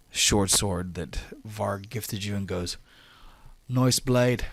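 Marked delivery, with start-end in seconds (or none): 3.7-4.5 louder, higher dwarf voice sizing up a blade. Acted. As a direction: play a dwarf fighter admiring a weapon. Game master plays Bazrin.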